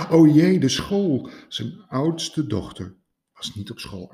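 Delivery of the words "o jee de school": high, loud alarmed cry, then falling; panicked gasp. Acted. Rabbit hearing his daughter is missing, alarmed and high. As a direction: blurt it out in sudden alarm. Konijn panics over phone call.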